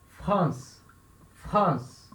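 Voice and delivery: 'France' with a guttural R right after the F; French 'France', R scraped in the throat. Shown as a demonstration. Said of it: "da garganta".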